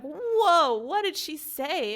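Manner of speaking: loud, high gasp of disbelief at what she read; "Whoa" blurted high and loud, then slow; shocked reaction. Retold or imitated. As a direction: react like the line just floored you. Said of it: "I was like".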